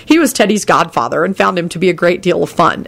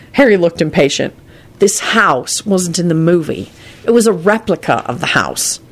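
plain reading voice

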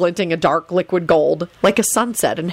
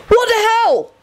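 high, drawn-out outcry; high-pitched exclamation; yell; slower than usual. A narrator's reading. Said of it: "he yelled".

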